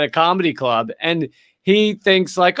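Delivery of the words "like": ordinary delivery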